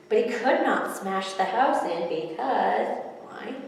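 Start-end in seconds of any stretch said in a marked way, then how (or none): none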